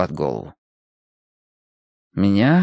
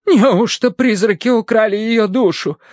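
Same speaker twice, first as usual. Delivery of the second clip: high, slow horrified gasp; terrified Irish maid; 10 semitones higher than usual. A narrator's reading. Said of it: "ужаснулась Колин".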